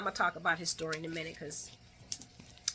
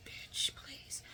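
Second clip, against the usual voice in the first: whispered, softer, scornful aside; dismissive whisper; 13 dB quieter than usual. Retold or imitated. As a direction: hiss a contemptuous brush-off. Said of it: "I'm looking at her like".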